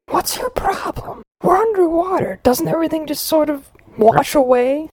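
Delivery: put-on high young boy whisper. A narrator's reading. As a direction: whisper like a curious kid. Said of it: "Steve whispered".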